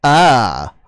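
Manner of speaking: loud slow frustrated tirade; exasperated yelling. Real, not acted. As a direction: shout it out, fed up with the fake voice. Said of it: "It makes me so mad".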